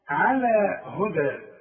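على هدى ending in a long ا sound, slow; alif ending. Shown as a demonstration. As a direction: read the ى as a stretched alif. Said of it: "تكتب بالياء وتنطق بالألف". (نطق الألف المقصورة ممدودة)

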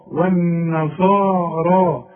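والنصارى with a light و, heavy ص and both alifs held; والنصارى slowly, each long vowel two beats; slow correct model. Shown as a demonstration. Teacher modelling the natural length. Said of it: "نقول".

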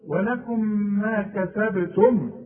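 "ولكم ما كتبتم" with the meem of "ولكم" sounded clearly before "ما", slow and drawn; clear meem in recitation. Shown as a demonstration. Teacher reciting to a student, showing the meem of "ولكم" pronounced clearly. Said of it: "لازم تظهرها عند الوقف وعند الوصل".

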